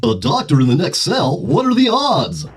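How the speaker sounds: lightly voiced vintage radio announcer; old-time radio announcer. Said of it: "in the style of an old-timey radio announcer".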